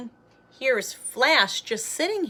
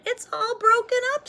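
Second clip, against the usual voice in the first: put-on 1 high kid voice; 13 semitones higher, 5 dB louder, faster than usual. Murray voiced.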